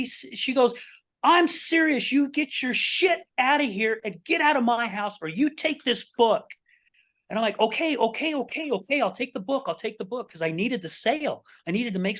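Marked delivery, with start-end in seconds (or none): 1.2-6.4 imitated high, insistent ultimatum; dead-serious demand. Customer repeats her threat to throw salesman out, dead serious. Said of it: "she was dead serious". 7.6-10.3 high, placating repeated agreement; hasty surrender. Retold or imitated. Salesman needing the sale yields to customer, placating.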